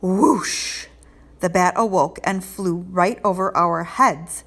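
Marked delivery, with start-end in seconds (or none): none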